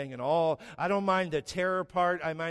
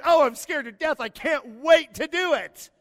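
high, loud mock-cheerful sarcasm; husband sarcastically answering his wife; sarcastic; 10 semitones higher, 7 dB louder than usual. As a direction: fake enthusiasm that means the opposite.

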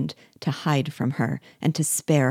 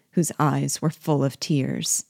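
ordinary delivery, nothing marked

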